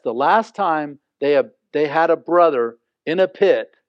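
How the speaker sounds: usual speaking voice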